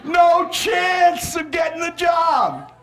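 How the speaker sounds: slow, high mock outburst; exaggerated shout. Shown as a demonstration. Speaker mocking a job seeker's hopes, exaggerated shout. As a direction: draw it out high and very slowly.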